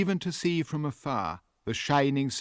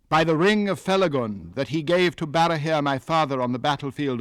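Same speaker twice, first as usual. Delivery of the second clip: louder, proud and unbowed defiance; 3 semitones higher, 5 dB louder than usual. Acted. Young man defies the elf king before his court, proud and louder. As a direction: stand tall and throw each word back at him. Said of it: "His words were proud".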